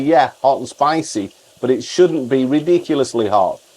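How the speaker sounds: ordinary talk, nothing marked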